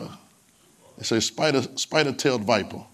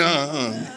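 put-on toddler grunts. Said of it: "she do like that".

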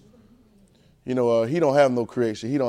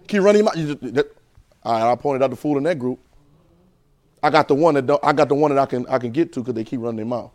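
ordinary talk, nothing marked

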